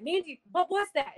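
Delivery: startled. Retold or imitated. Jenny to Mandy after the scare on the stairs, startled. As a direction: imitate someone who just got a fright. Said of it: "she was just really startled".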